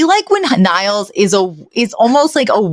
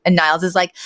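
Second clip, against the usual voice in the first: usual speaking voice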